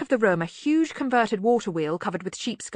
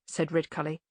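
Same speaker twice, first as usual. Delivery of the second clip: usual speaking voice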